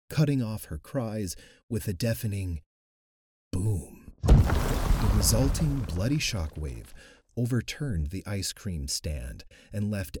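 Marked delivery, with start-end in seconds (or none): none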